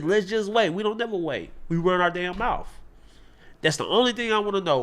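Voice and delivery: ordinary talk, nothing marked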